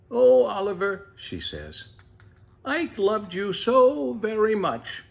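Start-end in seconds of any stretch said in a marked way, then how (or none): 0.1-1.1 put-on higher, louder, tender old mother; comforting mother tortoise. 2.6-5.0 put-on higher and slow, a loving old mother; comforting mother tortoise.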